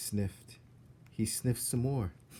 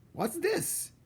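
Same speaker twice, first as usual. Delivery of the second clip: surprised cook. Acted. Cook spots something.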